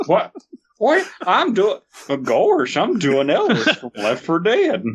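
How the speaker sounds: high, goofy cartoon voice; hammed-up cartoon dope. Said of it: "my name's Goofy and I'm doing Ellis".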